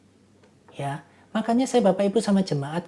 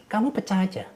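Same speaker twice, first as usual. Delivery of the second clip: usual speaking voice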